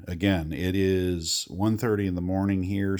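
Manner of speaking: ordinary delivery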